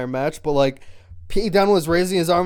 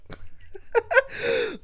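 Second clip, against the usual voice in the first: laugh. Real, not acted. Laughing at own retelling.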